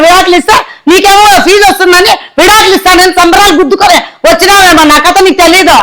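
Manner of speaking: usual speaking voice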